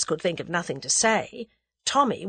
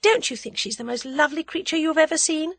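high-pitched, leading question; teasing heroine, pitched well up; spirited young heroine; 10 semitones higher than usual. A narrator's reading. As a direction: probe him in a high heroine's voice. Voices heroine teasing Tommy.

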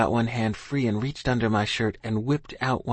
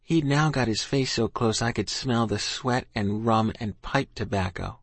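usual speaking voice

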